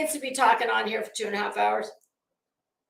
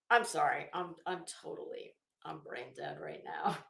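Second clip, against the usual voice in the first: normal talking voice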